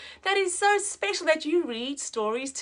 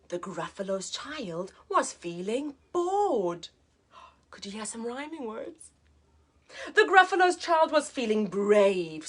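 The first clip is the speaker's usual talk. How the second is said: put-on lively read-aloud for children; teacher reading a picture book to a child, lively; storybook narration; 4 semitones lower than usual. A narrator's reading.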